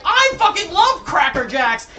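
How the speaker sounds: loud, high, slow gushing; mock an influencer's gushing, loudly; hammed-up social media model enthusiasm; Instagram model. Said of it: "Instagram model that's like".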